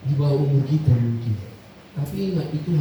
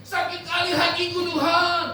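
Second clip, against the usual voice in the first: crying out to God; 14 semitones higher, much slower than usual. Retold or imitated. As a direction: wail it up to God in pain. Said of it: "dia berteriak-teriak".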